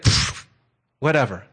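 put-on low, unimpressed teen brush-off; dismissive teenager. Demon to powerless disciples, teenage dismissiveness. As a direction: shrug it off like a bored teenager. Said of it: "demons in the face of powerless people are like teenagers".

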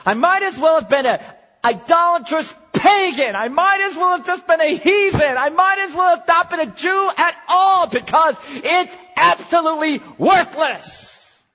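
loud, high, emphatic preaching; exasperated Paul. Retold or imitated. Preacher voicing Paul disowning his heritage, exasperated. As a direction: hammer it out in mounting exasperation. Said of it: "is what Paul says".

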